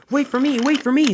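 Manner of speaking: high, urgent call from a latecomer. A narrator's reading. Late engine calling to the others, high and urgent.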